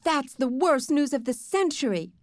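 high-pitched dismayed outcry; crying out. A narrator's reading. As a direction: wail it as terrible news. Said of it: "Sheila cried".

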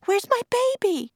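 high, frantic mother's voice; desperate high-pitched question; panicked cry. A narrator's reading. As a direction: plead in a terrified high voice. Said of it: "the mother cried".

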